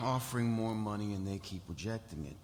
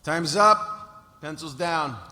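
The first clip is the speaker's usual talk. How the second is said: loud, high announcement; yell; 5 semitones higher, 13 dB louder, much slower than usual. Acted. Proctor ending the test, yelling out. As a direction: call it across the whole room. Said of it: "the proctor yells".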